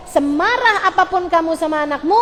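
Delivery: ordinary delivery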